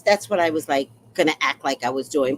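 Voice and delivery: usual speaking voice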